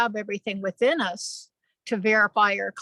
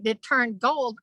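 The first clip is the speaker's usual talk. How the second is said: ordinary delivery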